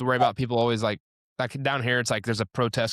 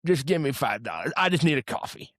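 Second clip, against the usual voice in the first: a mildly mocking beggar voice, pitched up; a hint of a pleading stranger asking for cash; imitating homeless people; 2 semitones higher than usual. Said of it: "homeless people are like".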